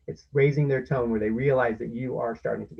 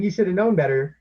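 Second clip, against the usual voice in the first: scolding; 6 semitones higher, 11 dB louder than usual. Shown as a demonstration. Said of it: "Instead of saying".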